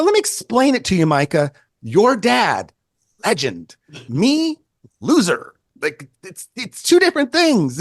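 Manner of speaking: high, emphatic mock scolding; mock exasperated lecture. Photographer setting the musician straight about his dad, mock exasperated. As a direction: hammer out the contrast in hammed-up exasperation.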